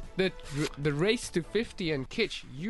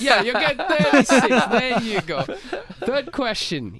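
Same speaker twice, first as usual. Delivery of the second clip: laughing; 8 semitones higher, 7 dB louder than usual. Real, not acted. Laughing through quiz joke.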